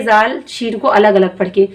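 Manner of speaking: ordinary talk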